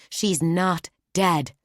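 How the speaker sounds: slow, loud, stubborn insistence, pressing on dead. Acted. Nonna rebuffing Keot, loud slow stubborn denial. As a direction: refuse to accept it, leaning on each word.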